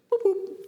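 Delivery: high chirpy mock beeps of a monitor; beep imitation. Trainer mimics the detector for the class, high mock beeps. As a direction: chirp it like a gas detector switching off.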